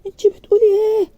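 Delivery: high, loud cry of shock; shocked outcry. A narrator's reading. Uncle shocked by her refusal, loud outcry. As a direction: blurt it out stunned. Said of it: "علي بصدمة كده".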